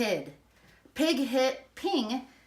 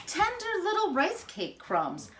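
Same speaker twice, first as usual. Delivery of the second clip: plain reading voice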